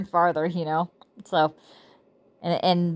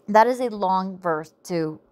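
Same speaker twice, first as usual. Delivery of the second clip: ordinary talk, nothing marked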